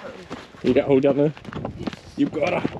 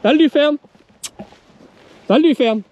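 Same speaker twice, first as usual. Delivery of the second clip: loud, raised dog command; calling out; 11 semitones higher, 5 dB louder than usual. Real, not acted. Farmer calling pup off lambs, loud high command. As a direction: call it out high to carry.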